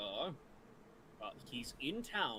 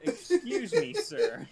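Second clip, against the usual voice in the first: laughing mock offense; 13 dB louder, much slower than usual. Mock offense at player joke.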